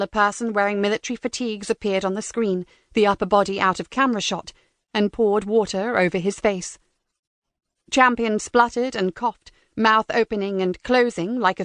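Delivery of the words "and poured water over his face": usual speaking voice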